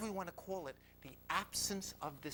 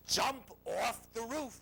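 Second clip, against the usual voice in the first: slow, loud, higher command; commanding inner voice; 7 semitones higher, 6 dB louder, slower than usual. Retold or imitated. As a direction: push each word out as an insistent order. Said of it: "the voice says".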